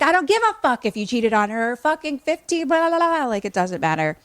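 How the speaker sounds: loud, high mock tirade; hammed-up angry outburst that tumbles into blah-blah; exasperated yelling. Adam firing back in a quarrel, exasperated mock yelling.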